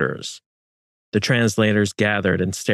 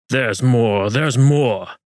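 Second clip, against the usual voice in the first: sing-song mocking echo, a bit louder; mocking imitation; 4 semitones higher, 4 dB louder, much slower than usual. A narrator's reading. Almagro mocking Juan's words, mocking echo. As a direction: mimic him to ridicule him. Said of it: "Almagro mocked".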